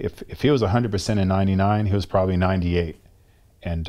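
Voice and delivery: ordinary talk, nothing marked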